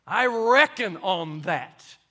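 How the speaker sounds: I reckon on that, slow and loud with reckon leaning hard; strong reckon. Shown as a demonstration. Preacher claims the word reckon for his flock, firm and slow. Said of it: "But it's a strong word".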